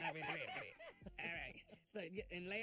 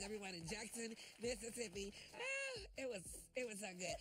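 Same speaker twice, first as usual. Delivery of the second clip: ordinary delivery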